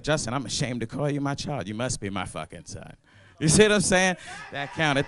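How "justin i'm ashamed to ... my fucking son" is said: mother disappointed. Retold or imitated. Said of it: "She was like".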